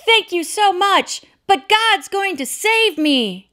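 slow, high call upward; shouting back. Retold or imitated. Man on the roof shouts back to the helicopter. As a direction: shout up your stubborn refusal slowly. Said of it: "he shouted back".

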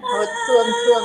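high sobbing shout; high, tearful cry. Acted. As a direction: cry it out in grief. Medium cries out.

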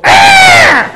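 sudden high yell; mock startling shout. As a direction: blast a loud yelp to silence them. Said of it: "why didn't they go".

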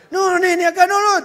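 high, loud hasty denial; mock loud scolding. Lawyer shouting down the boy who confessed. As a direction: hush the kid, loudly. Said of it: "le dice el nene".

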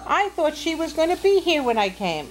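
put-on high-pitched storybook girl; wondering little girl. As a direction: wonder aloud in a high girl's voice.

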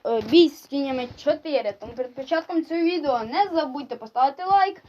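ordinary talk, nothing marked

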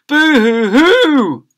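high, slow, loud mock wail; baby boo-hoo crying. Baby wailing at birth, loud hammed-up boo-hoo. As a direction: bawl it out like a huge baby. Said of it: "burst into floods of tears".

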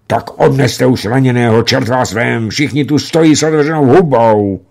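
high, loud gruff rebuke; gruff scolding. A narrator's reading. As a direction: snap at the crowd to get moving. Commander scolds the gaping crowd.

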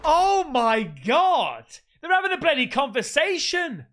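loud, high, disbelieving outburst; startled exclamation. Real, not acted. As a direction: exclaim in real shock, pitch swooping up. Startled by voices in the building.

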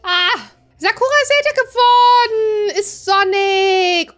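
high, loud, gushing news; a slow, swooning coo of delight; a showy burst of joy over a grown-up child; delighted announcement. Pretend. Game child grows up.

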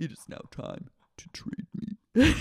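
whispered mock-demonic decree; Katie Maloney. Katie announcing her dark power, whispered menace. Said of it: "And then she goes".

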